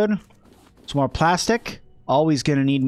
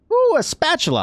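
delighted; 14 semitones higher than usual. Real, not acted. Delighted find.

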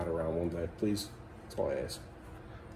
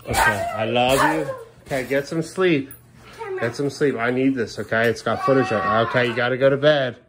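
firm loud parent; 6 semitones higher, 9 dB louder than usual. Real, not acted. Sending kid to bed, needs camera.

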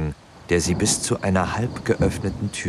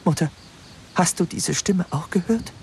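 fearful question; 11 semitones higher than usual. A narrator's reading. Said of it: "fragte Biel ängstlich".

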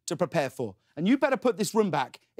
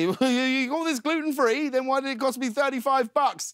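fake laugh; 8 semitones higher than usual. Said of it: "practice a good fake laugh".

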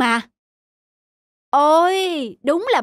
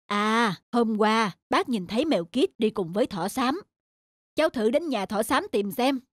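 put-on low kindly old man voice; old uncle; 6 semitones lower than usual. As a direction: ask gently like an old uncle.

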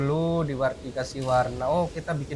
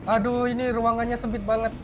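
high panicked gasps; frantic, fleeing in fear; being chased; 7 semitones higher than usual. Real, not acted. Monster chasing them in game.

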